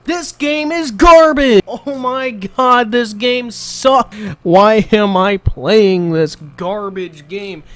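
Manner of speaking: high, slow whining complaint; raging whine. Real, not acted. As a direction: drag out the disgust at the game. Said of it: "how many times I fucking raged".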